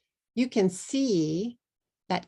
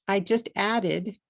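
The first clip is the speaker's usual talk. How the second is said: usual speaking voice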